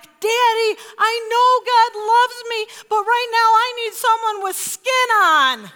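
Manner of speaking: put-on high, loud plea from a little girl; exasperated child yelling back. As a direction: act out a frightened kid hollering for Daddy. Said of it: "Monica yells back".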